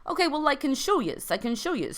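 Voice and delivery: gentle reassurance from the trout in a light Boston lilt; lightly voiced trout consoling the orb. Trout character speaks.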